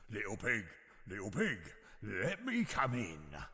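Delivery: a hint of low gruff wolf; lightly voiced menacing wolf. A narrator's reading. Wolf at the brick house door, lightly gruff. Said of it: "said in a low and gruff voice".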